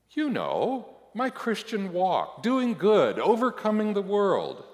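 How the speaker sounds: high, loud, gushing list; eager self-justification. A narrator's reading. Eager self-justifying reply to the Lord.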